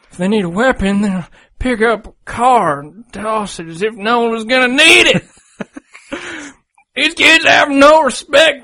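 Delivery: put-on high, shaky cranky old geezer; old man voice. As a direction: read it like a grumpy old man yelling at kids. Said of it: "He wants it read in old man voice".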